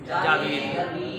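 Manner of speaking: slow "जावीद" with long ee; drawn "जावीद", jaa-veed; teacher answers with "जावीद" slowly; correct pronunciation. Shown as a demonstration. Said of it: "क्या दुरुस्त है".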